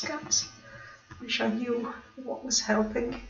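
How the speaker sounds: soft worried murmur to herself; muttered aside. Real, not acted. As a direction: mutter it under your breath while fixing tech. Reading stream warnings, muttering aside.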